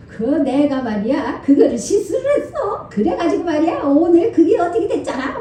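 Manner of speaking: lightly voiced jovial old scholar, laughing; a hint of a booming, good-natured elder; hearty old professor. Pastor imitating the professor confessing his mistake, lightly hearty. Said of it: "막 이러면서".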